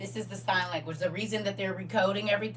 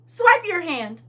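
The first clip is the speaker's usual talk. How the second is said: high, loud NPC command; 8 semitones higher, 7 dB louder, slower than usual. Pretend. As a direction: say it like a scanner NPC. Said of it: "my version of the N P C".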